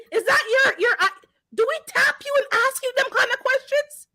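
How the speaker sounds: high, laughing through the words; laughing disbelief. Real, not acted. Woman mocking the hair question, laughing disbelief. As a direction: laugh at how absurd it is.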